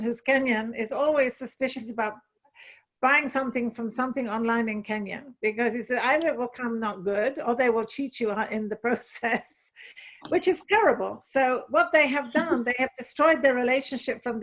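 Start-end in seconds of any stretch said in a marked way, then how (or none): none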